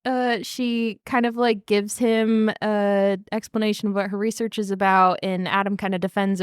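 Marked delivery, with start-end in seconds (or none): none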